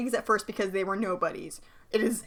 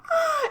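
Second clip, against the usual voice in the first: mock gasp. Reacting to sad band backstory.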